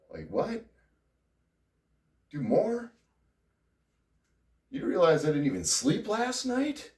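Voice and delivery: high, loud disbelief; retold exasperated protest; incredulous. Incredulous retort to wife.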